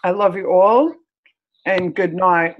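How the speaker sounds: ordinary talk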